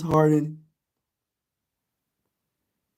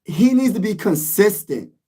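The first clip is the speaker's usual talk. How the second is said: ordinary delivery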